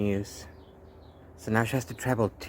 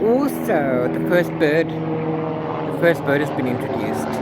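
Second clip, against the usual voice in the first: excited; 5 semitones higher, 7 dB louder than usual. Real, not acted. Excited news about the book.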